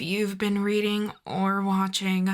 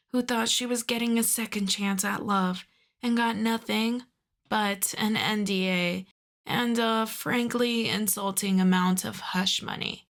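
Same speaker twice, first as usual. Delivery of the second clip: usual speaking voice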